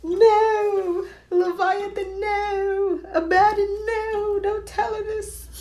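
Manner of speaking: high, loud, pleading whine to demons; hammed-up panicked plea of Kurt; mock desperate begging. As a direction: beg the demons frantically in a mocking high voice. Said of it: "He's like".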